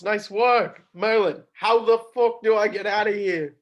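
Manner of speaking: ordinary talk, nothing marked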